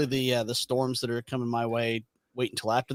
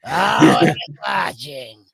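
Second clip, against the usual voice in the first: slug lady impression; 5 dB louder, slower than usual. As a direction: creep it out slowly like the slug lady. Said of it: "the slug lady from Monsters, Inc?".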